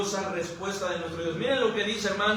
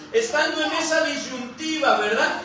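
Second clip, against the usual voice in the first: ordinary talk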